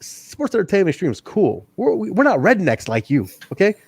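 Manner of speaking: high, scolding know-it-all voice; mock condescending wrestler. Siaki scolds Young in a high, mock condescending voice. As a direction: talk down to him like a smug boss. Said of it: "he goes".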